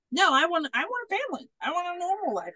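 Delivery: high, whiny-style counter-plea; mock plaintive. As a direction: imitate his pleading, pitched well up. Retold man pleads for family.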